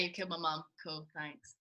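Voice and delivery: usual speaking voice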